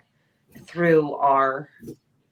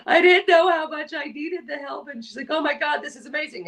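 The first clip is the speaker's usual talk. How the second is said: mock near tears; 8 semitones higher than usual. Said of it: "she's like, almost crying".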